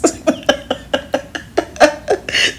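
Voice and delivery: high breathy giggle. Real, not acted. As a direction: let the laugh bubble out. Laughs at own confession.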